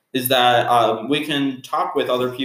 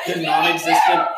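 shout; 4 semitones higher, 4 dB louder than usual. Pretend. Mock goodbye to listeners.

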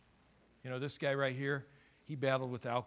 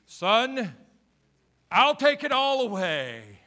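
a hint of a booming father's voice; the Father speaking; 8 semitones higher, 14 dB louder, slower than usual. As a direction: reassure him like a loving father. Voicing the Father.

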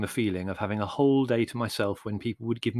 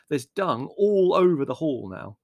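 slow, loud, raised complaint; disgusted complaint; 5 semitones higher, 5 dB louder than usual. A narrator's reading. Larry complaining about dung in the hall, disgusted. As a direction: dwell on the mess, appalled.

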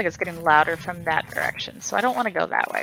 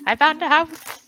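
imitating a co-player's yelling, higher and louder; mock panicked yell; 8 semitones higher, 6 dB louder than usual. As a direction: tease with a small mock scream. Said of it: "like, screaming and yelling".

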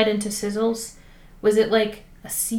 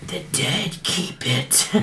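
ominous intoning; 6 semitones lower, 9 dB quieter, much slower than usual. Pretend. Reading ominous door inscription.

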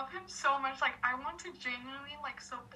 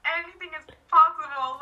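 louder and higher, thrilled declaration; elated exclamation; 5 semitones higher, 15 dB louder than usual. Retold or imitated. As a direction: proclaim it with giddy joy. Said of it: "I was, after that, I was like".

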